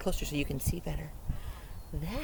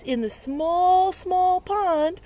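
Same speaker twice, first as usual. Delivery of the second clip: dramatic read-aloud; 10 semitones higher, 8 dB louder, slower than usual. A narrator's reading. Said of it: "It says".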